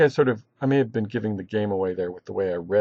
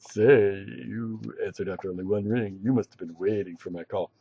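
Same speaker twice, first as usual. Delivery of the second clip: Zap Brannigan; 2 semitones lower than usual. Acted. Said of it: "maybe you could picture Zap Brannigan character".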